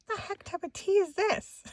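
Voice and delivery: very high, squeaky disbelief; baffled squeal. Retold or imitated. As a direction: ask it high, on the verge of cracking up. Puzzled discovery, on verge of laughing.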